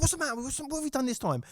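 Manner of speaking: exasperated boss. Retold or imitated.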